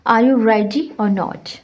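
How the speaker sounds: very slow question, "or" said without its r; slow model question; slow demo reading. Shown as a demonstration. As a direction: read it slowly so the class hears the weak "or". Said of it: "R is silent".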